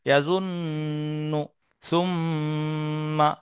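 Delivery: ইয়াজুন্নু, সুম্মা with long hummed nn and mm; ghunnah drill. Shown as a demonstration. Teacher reads drill words to learners, long nasal hum in ইয়াজুন্নু, সুম্মা. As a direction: hold the doubled n and m of ইয়াজুন্নু and সুম্মা as slow level hums.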